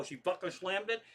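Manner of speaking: ordinary delivery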